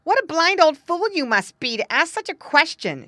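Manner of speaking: high, loud, rude outburst; angry retort. A narrator's reading. Freezing daughter to King Frost, angry retort. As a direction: snap back at him indignantly and loudly. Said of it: "she answered angrily".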